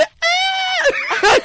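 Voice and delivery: very high, drawn-out mock shriek; Flanders scream. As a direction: imitate a cartoon character's girlish-high scream. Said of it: "el grito de, de Flanders".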